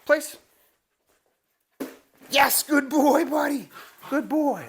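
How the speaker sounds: excited cheering at the dog; puppy praise. Real, not acted. Commanding then praising puppy.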